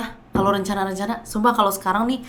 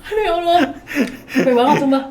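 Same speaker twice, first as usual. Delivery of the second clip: laughing; 7 semitones higher, 6 dB louder than usual. Real, not acted. Cracking up at penguin joke.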